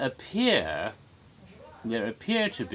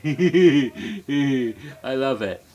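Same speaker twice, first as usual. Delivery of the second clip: slow, laughing, much louder relish; gleeful laugh over the spooky effect; laughing delight; 9 dB louder, much slower than usual. Real, not acted. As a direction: savour it through a real laugh. Delighted by evil eyes effect.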